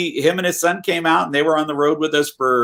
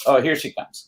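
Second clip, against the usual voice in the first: usual speaking voice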